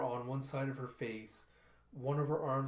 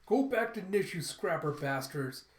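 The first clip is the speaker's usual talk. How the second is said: high, loud protest yell; acted protester's shout; mock screaming; 4 semitones higher, 5 dB louder than usual. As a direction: hurl it angrily at them, then ease off. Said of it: "you have her screaming".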